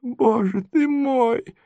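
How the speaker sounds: high, soft, slow lament; poor old prince. A narrator's reading. Poor prince laments his plight, soft and high. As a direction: sigh it out helplessly in a high voice. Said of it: "говорил бедный князь".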